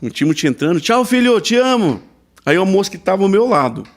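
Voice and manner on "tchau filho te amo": high, projected goodbye; retold showy public farewell; calling out loud. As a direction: call to your son for everyone to hear. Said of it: "eu falo em português alto".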